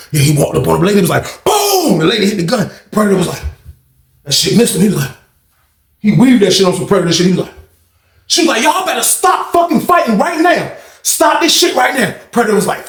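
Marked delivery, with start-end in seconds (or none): 1.4-2.0 high sharp imitated gunshot; mimicked shot boom. 8.6-12.1 much higher scolding yell; guard yelling at brawlers; retold order. Guard lady ordering them stop.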